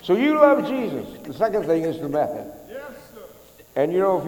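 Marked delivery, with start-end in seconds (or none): 0.0-1.2 high, loud preaching; impassioned sermon shout; fervent preaching. Acted. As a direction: drive the point home from the pulpit.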